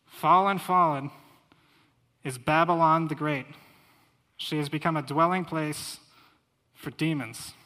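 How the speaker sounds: scripture proclamation. Acted. Preacher reads Babylon's fall to the congregation, loudly proclaiming. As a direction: announce Babylon's fall like a herald. Reads Revelation eighteen aloud.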